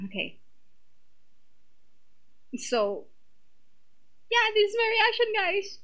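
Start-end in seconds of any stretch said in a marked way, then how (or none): 4.3-5.8 much higher, chirpy address to viewers; bright sign-off. Real, not acted. Vlogger telling her viewers this is her reaction, high and bright. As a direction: pop up brightly to the camera.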